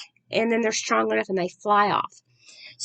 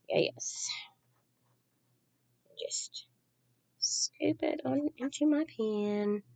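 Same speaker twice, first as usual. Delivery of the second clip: whispering to herself; 5 semitones higher, 10 dB quieter, slower than usual. Real, not acted. Quietly working on her craft.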